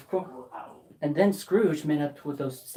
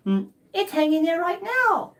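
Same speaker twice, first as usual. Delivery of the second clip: put-on high boy voice; 11 semitones higher, 4 dB louder than usual. Boy answers Scrooge.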